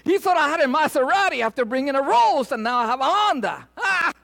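high, loud punchline laughed out. Acted. Preacher shouting the joke's payoff to his congregation. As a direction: crow the joke to the crowd.

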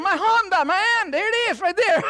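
high, loud, proud announcement; proud shout. Retold or imitated. Preacher shouting his proud answer to the valet. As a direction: show off the old car with a loud high cry.

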